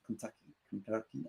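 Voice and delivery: put-on soft, unsure stab at a Kentucky accent; tentative Kentucky accent. Host greeting a Kentucky viewer, half-hearted quiet Kentucky accent. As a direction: try out a Kentucky accent under your breath. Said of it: "I don't know how that accent goes".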